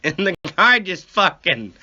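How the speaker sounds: ordinary talk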